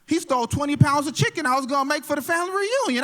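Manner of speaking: lightly voiced aunt, worked up; a hint of an indignant auntie; upset aunt. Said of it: "She's like".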